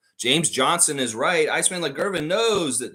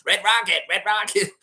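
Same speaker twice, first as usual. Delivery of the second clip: lighter Cartman echo; 9 semitones higher, 5 dB louder than usual. Retold or imitated. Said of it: "I shouldn't say it like Cartman".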